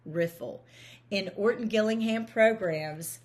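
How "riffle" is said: "riffle" with a short, closed i; short i in "riffle", as in "giggle"; short-i nonsense word. Shown as a demonstration. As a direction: say "riffle" as RIFF-ul. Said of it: "closing in the I".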